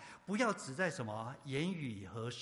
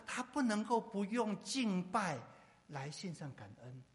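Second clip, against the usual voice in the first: normal talking voice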